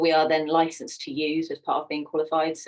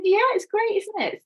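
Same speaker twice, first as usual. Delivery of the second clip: high-pitched, upbeat mock parents; imitated breezy approval; 4 dB louder than usual. Parents answering the shocked teacher, high breezy approval. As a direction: chirp it brightly, as proud parents would.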